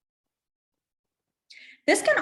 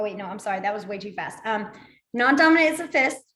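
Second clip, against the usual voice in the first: normal talking voice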